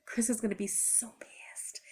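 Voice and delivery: slow, hushed, voiceless realization; dreading whisper. Retold or imitated. Woman thinking how angry Chris will be, dreading whisper. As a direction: whisper it slowly, as a retold sinking thought. Dreading reaction to totaled car.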